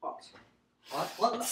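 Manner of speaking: normal talking voice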